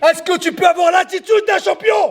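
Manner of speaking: high, insistent shout; shouted challenge. Real, not acted. Coach challenging fighter to be a champion, shouting. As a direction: demand a yes from him.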